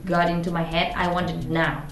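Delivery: usual speaking voice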